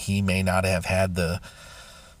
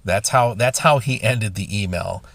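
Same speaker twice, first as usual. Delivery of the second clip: ordinary talk, nothing marked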